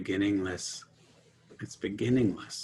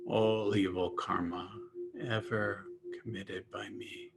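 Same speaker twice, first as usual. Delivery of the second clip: soft meditation voice with long pauses; guided meditation; 7 dB quieter than usual. Real, not acted. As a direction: offer each phrase quietly, then leave space. Leads meditation on chant phrases.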